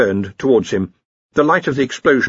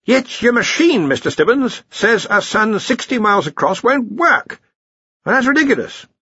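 indignant bluster; 12 semitones higher than usual. A narrator's reading. Arch-Chancellor indignant.

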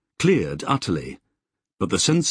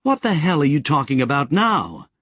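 snapping; 6 semitones higher than usual. A narrator's reading. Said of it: "Sally snapped".

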